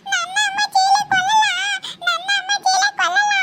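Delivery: put-on crying child. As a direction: wail in protest like a small child. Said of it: "ಅಳ್ಬಾರ್ದಮ್ಮ". (ಅಳುತ್ತಾ ಹಠ ಮಾಡುವ ಮಗು)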